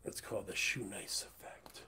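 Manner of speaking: whispered aside. Acted. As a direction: whisper it like a secret. Repeats catchphrase whispered.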